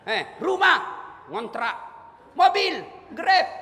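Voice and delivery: high, loud mocking questioner; mock interrogator. Preacher mimics an angel grilling the poor, loud mocking questions.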